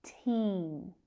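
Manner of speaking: Team with the ee held; slow, careful model of Team with long ee; long vowel. Shown as a demonstration. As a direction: lengthen the ee in Team. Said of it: "That long vowel sound".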